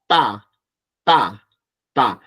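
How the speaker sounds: ta said loud and hard, with a sharp hit in the mouth; tense t. Shown as a demonstration. Teacher drills the tense Korean t with the class. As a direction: strike each ta hard. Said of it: "Hacemos el golpe en la boca".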